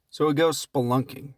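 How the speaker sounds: ordinary talk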